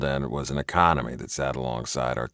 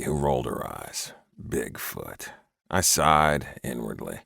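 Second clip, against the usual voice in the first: plain reading voice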